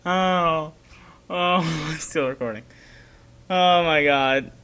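wailing laugh, close to crying; helpless laughter. Real, not acted. Player laughing at own scare, helpless laughter. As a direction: groan the words through laughter. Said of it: "I'm laughing, not crying".

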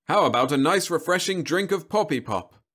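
high, loud sales pitch; chirpy machine pitch; robot salesman voice. Acted. Robot sells drink.

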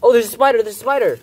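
high, loud alarmed cry; screaming at a spider. Real, not acted. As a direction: shriek about the spider. Spots a spider.

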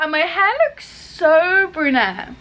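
ordinary talk, nothing marked

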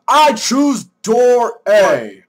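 slow, loud declaration; game-show contestant. Pretend. Contestant giving the host his choice, slow loud announcement.

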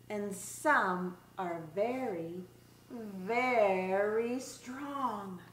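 lively, singsong picture-book read-aloud; reading to children. Acted. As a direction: read it like story time for little kids.

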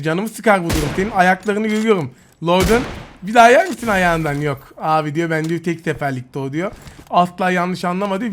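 ordinary talk, nothing marked

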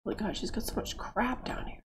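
very soft, whispered aside; muttering to self. Real, not acted. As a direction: mutter it under your breath while busy. Muttering to self while playing.

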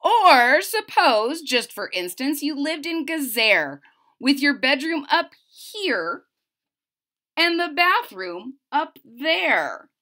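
lively storybook read-aloud; bouncy picture-book reading voice; reading to children. Acted. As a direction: bring the rhymes to life for little listeners.

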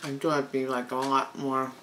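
muffled between bites; chewing while talking. Real, not acted. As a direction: mouth partly full. Seen 3: Lips pressed, jaw working over held ice-cream sandwich throughout.

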